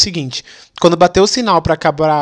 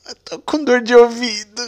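faked crying kid; 5 semitones higher than usual. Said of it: "coloquei a mãozinha no meu ouvidinho".

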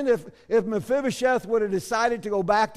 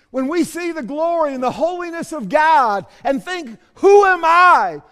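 loud, trembling awe; awestruck cry; 9 semitones higher, 10 dB louder than usual. Pretend. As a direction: cry out overwhelmed before God. Awestruck cry in God's presence.

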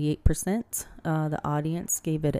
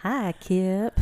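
country accent; 4 semitones higher than usual. Real, not acted. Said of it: "I said that real country".

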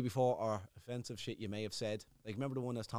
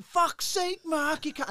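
annoyed shout; 7 dB louder, much slower than usual. Retold or imitated. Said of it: "they're like".

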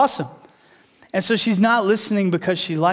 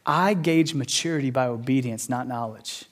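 hushed, solemn; 6 semitones lower, 6 dB quieter than usual. Retold or imitated. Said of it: "Jesus comes along and goes".

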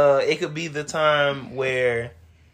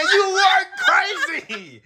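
laughing disbelief; slower than usual. Retold or imitated. Reacting to her late confession.

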